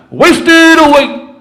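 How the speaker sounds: loud broken snatches of song with long gaps; satellite radio cutting out. Shown as a demonstration. Showing the audience his radio losing signal, broken bits of song. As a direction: sing fragments like a signal dropping in and out. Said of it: "Sounds like this when I'm driving".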